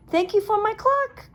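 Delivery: high, loud grateful call; Ted calling thanks as friends go home. A narrator's reading. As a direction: call after friends leaving. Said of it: "called Ted".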